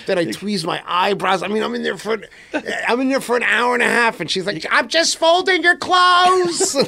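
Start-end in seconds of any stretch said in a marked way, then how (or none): 4.7-6.8 high, slow sing-song imitation of his wife; mock wife. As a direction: play the wife sweetly calling through the door. Wife's mock reply in shower.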